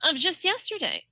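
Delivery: mock speech-making in a lifted voice; mimicked politician. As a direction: impersonate her rambling earnestly, pitch lifted. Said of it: "But then she goes".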